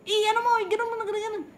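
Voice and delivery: doctor scolding her to lift her hips; exasperated scolding. Retold or imitated. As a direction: snap the instruction in exasperation. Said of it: "Sabi niya".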